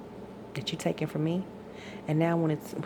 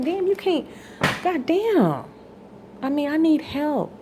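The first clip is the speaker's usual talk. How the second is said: much higher, loud, fed-up shouting; furious exasperation; 10 semitones higher, 7 dB louder than usual. Real, not acted. Telling her partner off for refusing to help, loud and furious. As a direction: let the frustration explode.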